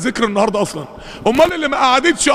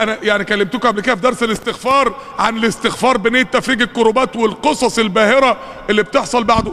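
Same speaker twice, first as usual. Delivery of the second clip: usual speaking voice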